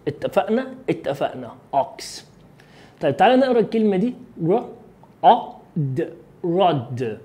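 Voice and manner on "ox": American أوكس with an open "ah"; نطق أمريكي. Shown as a demonstration. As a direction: say أوكس as "aaks", American style. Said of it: "عايز تتكلم أمريكي؟". (لكنة أمريكية، أوكس بألف مفتوحة)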